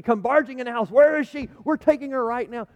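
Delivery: high, loud, commanding rescuer; urgent barging-in. Pretend. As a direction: burst in and take charge loudly. Imagined urgent paramedics barging in.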